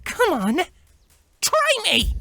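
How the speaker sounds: high, biting taunt; vicious taunt. Acted. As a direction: goad them to attack. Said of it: "Twilight viciously taunted".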